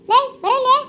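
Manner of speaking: loud summons; angry call. Acted. Wife angrily calls the husband over, loud. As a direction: call him over sharply.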